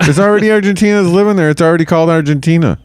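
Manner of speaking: excited. Real, not acted. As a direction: rush it out indignantly. Excited retort.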